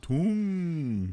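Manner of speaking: "Tongue" high, long, with a rounded oo; echoed Yorkshire "Tongue" with pursed-lip vowel; imitated Yorkshire pronunciation. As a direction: draw out "Tongue" as toom, through a straw. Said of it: "it's so through a, a straw".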